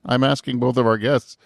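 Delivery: ordinary talk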